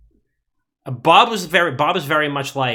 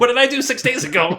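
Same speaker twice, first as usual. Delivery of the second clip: much higher pretend wounded question; hammed-up injured innocence; mock hurt friend; 10 semitones higher than usual. Continuing mock hurt bit.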